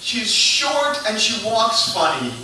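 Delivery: high, loud, frantic game-show shouting; game show grandma. Retold or imitated. Grandma and family as a shrieking game show.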